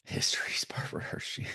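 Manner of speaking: whispered echo; mock whisper. Co-host echoing the actress's introduction, whispered. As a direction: repeat it in a hushed, voiceless whisper. Echoes co-host's line as joke.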